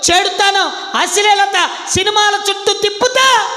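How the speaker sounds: loud, high shouted sermon; preacher rebuking parents at full volume; fiery preaching. Acted. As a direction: hammer it home to the congregation.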